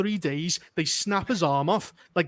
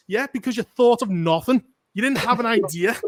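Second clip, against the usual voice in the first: normal talking voice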